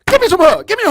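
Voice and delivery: loud, high hug request; affectionate shout. Retold or imitated. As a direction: call out loving and loud. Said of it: "a dude that can come and say".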